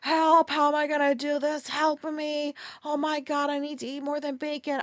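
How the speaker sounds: high, whiny mock panic; mock panicked wail. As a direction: whine it like a body giving up, half laughing. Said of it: "I was just like".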